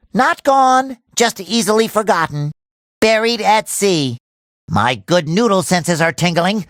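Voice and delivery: normal talking voice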